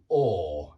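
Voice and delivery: "or" as a long British aw, no r; long or vowel. Shown as a demonstration. Teacher corrects the vowel for students, long "or" as aw. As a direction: draw "or" out, rounded. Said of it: "It's a longer sound".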